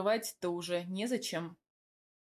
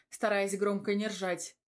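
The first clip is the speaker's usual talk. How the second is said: ordinary delivery, nothing marked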